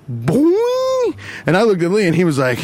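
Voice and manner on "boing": high sproing sound effect; a springy high boing; cartoon boing. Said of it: "eyes were like".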